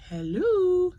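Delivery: a light mock of the daughter's high sing-song hello; imitating daughter's hello. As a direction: copy her chirpy phone greeting, lightly. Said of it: "She kinda answers like that".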